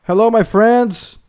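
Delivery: loud, high, slow hello across distance; drawn-out friendly shout; calling out. Real, not acted. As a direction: hail strangers from far away. Calling out to strangers in game.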